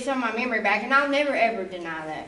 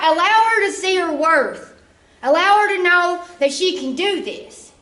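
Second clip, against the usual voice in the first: louder, slow pleading plea; grandma pleading with God; 5 semitones higher, 5 dB louder than usual. Retold or imitated. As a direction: press it like grandma begging God on her behalf. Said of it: "she looked at him and said".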